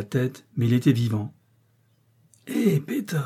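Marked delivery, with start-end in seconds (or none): 2.5-3.2 high call, held back in volume; airy, high-pitched hail. A narrator's reading. Comrades calling to the rescued man, crying out. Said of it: "lui criait-on".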